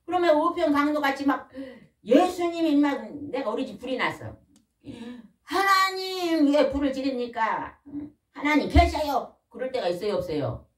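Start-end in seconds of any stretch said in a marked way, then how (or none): 5.4-9.3 slow, higher crying complaint to God; anguished plea. Acted. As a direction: cry out to heaven over the burnt house. Believer cries out to God.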